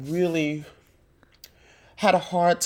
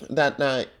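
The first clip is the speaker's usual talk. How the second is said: ordinary talk, nothing marked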